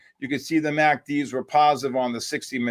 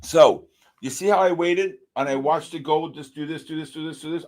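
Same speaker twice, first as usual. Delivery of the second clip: normal talking voice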